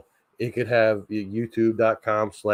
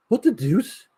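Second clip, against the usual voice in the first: attempted Stewie voice; 11 semitones higher than usual. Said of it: "now, Stewie, I can't really do".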